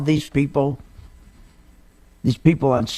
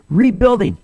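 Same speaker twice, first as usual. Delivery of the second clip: rebuilding with the re hit hard and louder; stress on RE; 3 semitones higher, 4 dB louder than usual. Shown as a demonstration. Radio host stressing the re in rebuilding. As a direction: lean on the re of rebuilding. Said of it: "emphasis on rebuilding".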